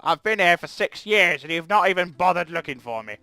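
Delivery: a loud, put-upon complaint in a different voice; whiny stranded diplomat. Pretend. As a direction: voice the neglected diplomat, aggrieved. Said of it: "who's probably like".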